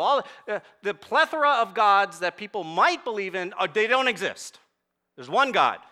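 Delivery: loud, high, driving pulpit emphasis; forceful preaching. Real, not acted. Preacher pressing the congregation, loud and insistent. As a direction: hammer home the one God to the congregation.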